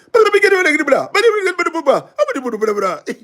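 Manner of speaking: laughing, high gibberish mimicking heated talk; mock argument babble. Mocking how locals sound when chatting, high laughing gibberish. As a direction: babble like a shouting match, laughing. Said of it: "it sounds like an argument".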